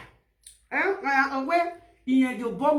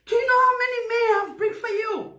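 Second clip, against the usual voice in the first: mother's retort; 7 semitones higher than usual. Retold or imitated. Mother voiced in the story.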